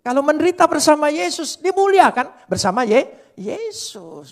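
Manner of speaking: loud, high preaching voice; fiery preaching. Acted. Preacher exhorting the church to bear insults, at full force. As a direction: drive it home from the pulpit.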